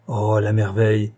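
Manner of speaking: plain reading voice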